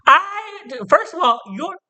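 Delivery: high-pitched indignant outburst; indignant squeal. Real, not acted. Offended outburst at girls doubting him.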